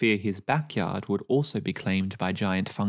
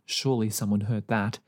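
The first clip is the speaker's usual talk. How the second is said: ordinary delivery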